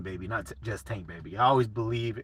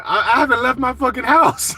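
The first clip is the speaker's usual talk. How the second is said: higher, louder mock protest of innocence; baffled player; 10 semitones higher, 7 dB louder than usual. Streamer imitating the player denying the rumor, indignant. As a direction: act out the player swearing he stayed home. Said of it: "He's like".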